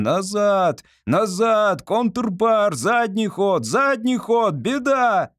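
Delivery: frantic high hoarse yelling, straining; desperate hoarse shout. Acted. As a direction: scream the orders hoarse, in panic. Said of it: "надрывно, неистово кричит охрипшим голосом". (надрывный хриплый крик)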